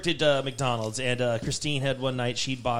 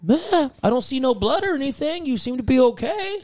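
high mock voice; 10 semitones higher than usual. Voicing the guys.

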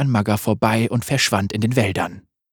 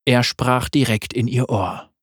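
ordinary delivery, nothing marked

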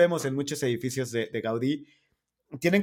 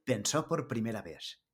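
put-on softer documentary narrator with a Spanish accent; 4 semitones lower, 8 dB quieter than usual. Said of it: "es voz de documental". (voz de documental con acento español)

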